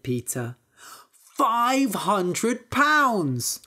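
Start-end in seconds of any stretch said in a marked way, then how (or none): 1.4-3.6 high, very slow proud announcement; triumphant boast. A narrator's reading. Little Peter boasts to his brother about the price, triumphant. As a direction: stretch out the big reveal gleefully.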